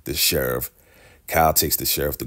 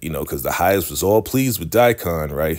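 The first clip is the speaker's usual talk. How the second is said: ordinary delivery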